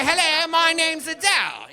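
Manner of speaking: high, slow mock British accent. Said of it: "why are you talking stupid".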